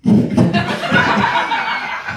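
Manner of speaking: breathless wheeze of laughter; wheezing laugh. Real, not acted. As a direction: laugh until the air runs out.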